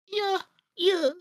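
high squeaky falsetto yeah; mock falsetto yeah. Friends mimicking squeaky voice.

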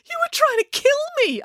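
very high, indignant accusation; squealed accusation; arguing. A narrator's reading. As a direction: argue back shrilly, pitch way up. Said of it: "argued Matilda".

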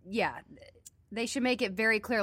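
ordinary talk, nothing marked

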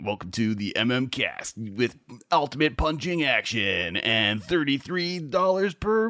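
a hint of a toy-ad announcer; commercial voice. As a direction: nineties action figure ad, lightly done. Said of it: "commercial voice man".

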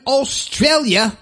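jokey attempt at an Australian accent. Host reading Karen's message from Australia, mock Australian accent. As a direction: put on a playful Aussie accent. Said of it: "I'll never do that accent again".

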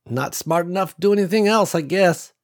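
lightly voiced old country woman; a hint of a wry old rancher woman; old farm woman. A narrator's reading. Ruby voiced by narrator.

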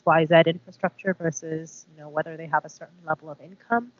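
ordinary talk, nothing marked

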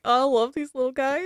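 high, delighted coo over little creatures; gushing delight. Retold or imitated. Host recalling her delight over Pukwudgies, high and gushing. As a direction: gush like you just met something adorable.

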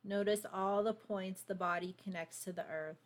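calm, unhurried meditation voice; guided meditation. Acted. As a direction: guide them gently into stillness. Leads a grounding meditation.

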